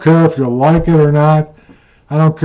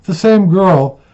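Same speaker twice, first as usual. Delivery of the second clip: normal talking voice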